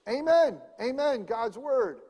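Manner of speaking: high, loud call of Truly, truly; elders calling out. Retold or imitated. Elders in the synagogue answering the Torah reading, high loud call. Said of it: "the elders of the church would yell out".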